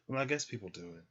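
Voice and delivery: usual speaking voice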